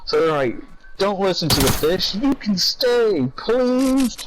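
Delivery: put-on high, slow pleading kids; kids begging. Voicing the kids begging the cat, high slow pleading. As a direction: beg like whining children. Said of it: "the kids were like".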